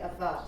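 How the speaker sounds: avat with a spoken V between two vowels; V sound. Shown as a demonstration. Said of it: "it can be pronounced a V".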